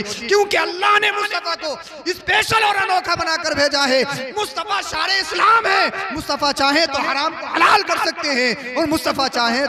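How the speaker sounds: high, driving, emphatic harangue; full-force preaching. Real, not acted. Preacher arguing against opponents about prayer rules, full-force preaching. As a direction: pile up each case for the crowd. Proclaims the Prophet's unique status.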